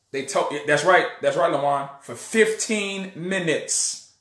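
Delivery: ordinary talk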